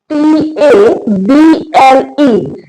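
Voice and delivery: slow letter-by-letter spelling; name each letter separately, drawn out; spelled out slowly. Shown as a demonstration. Teacher spelling a word for learners, slowly letter by letter.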